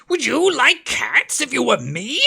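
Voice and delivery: high and loud indignant squeal; shrill passionate mouse. A narrator's reading. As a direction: mouse rounds on her, outraged. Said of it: "shrill, passionate voice".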